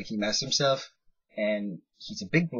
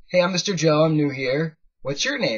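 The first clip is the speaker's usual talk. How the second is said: put-on bright greeting to a child; 5 semitones higher, 8 dB louder than usual. Greeting a hyper small kid.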